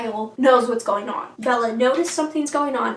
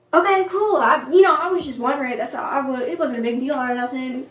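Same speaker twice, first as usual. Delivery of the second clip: imitating rejected boy; 2 semitones higher, 4 dB louder than usual. Mike turned down for the dance, mock awkward backpedaling. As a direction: backpedal awkwardly, settling down as you go.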